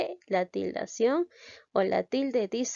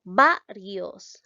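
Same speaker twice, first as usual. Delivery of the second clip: "Varios" with VA loud and drawn out; stress on VA; 6 dB louder than usual. Shown as a demonstration. Teacher showing pupils where the voice falls, stressing VA in "Varios". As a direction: lean hard on the first syllable of "Varios". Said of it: "dónde lleva la mayor fuerza de voz".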